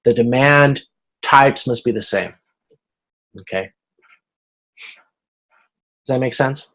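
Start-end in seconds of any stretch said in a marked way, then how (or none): none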